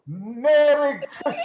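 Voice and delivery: loud, high, slow, laughing call; joyful shout. Acted. Scrooge greets passersby with a joyful shout. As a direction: fling the greeting down the street.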